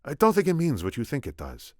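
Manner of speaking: high-pitched snap in the Spaniard's voice; snapped retort. A narrator's reading. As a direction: snap back at the boss. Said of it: "the Spaniard snapped".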